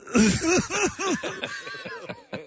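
high hammed-up wail; mock crying. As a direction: wail like an overwhelmed fan. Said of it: "was of somebody crying".

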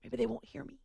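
very soft, nearly whispered; secretive hush. Pretend. Host dodging Green Bay listeners, in a secretive hush. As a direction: keep it down so nobody hears. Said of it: "I'm whispering".

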